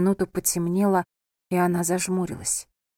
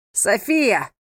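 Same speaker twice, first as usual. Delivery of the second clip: raised, drawn call of a name; 2 semitones higher, 4 dB louder than usual. A narrator's reading. Husband calls out to his wife, calling out. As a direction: call her name from afar. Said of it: "окликнул её родной голос".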